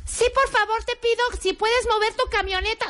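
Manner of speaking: high, shrill pleading voice; mock shrill request to move the van; squeaky pleading. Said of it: "acted parking quarrel".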